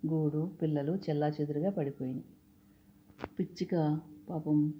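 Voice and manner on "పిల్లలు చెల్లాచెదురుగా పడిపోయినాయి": ordinary delivery, nothing marked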